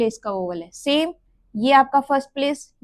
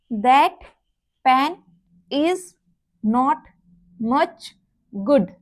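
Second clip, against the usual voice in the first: slow, each word set apart; word-by-word demo; much slower than usual. Shown as a demonstration. Teacher to students, phrase spoken word by word. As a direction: lay out the phrase one word at a time. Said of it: "अब इस phrase में ही आपके ये जो vowels हैं".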